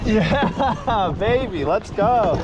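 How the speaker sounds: high, slow, laughing celebration; excited cheer. Real, not acted. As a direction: whoop it up, laughing. Celebrating a hooked fish.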